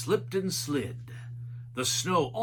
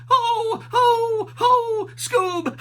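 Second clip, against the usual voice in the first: very high, loud wailing call; monster moan; 8 dB louder, much slower than usual. A narrator's reading. The monsters moaning through the blizzard, high and loud. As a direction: wail it like a ghostly spook.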